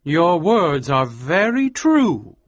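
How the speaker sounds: a touch of slow sadness; sadly. Acted. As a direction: say it sadly, unhurried. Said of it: "said Almer sadly".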